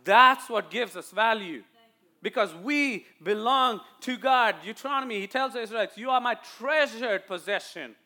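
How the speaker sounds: high, loud, insistent sermon; forceful preaching. Real, not acted. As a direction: drive the point home to the church. Preaches to the congregation.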